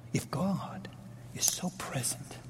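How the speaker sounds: whispered question, almost breathless; hushed. Acted. Preacher hushing the congregation, whispered. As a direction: drop to a whisper to draw them in.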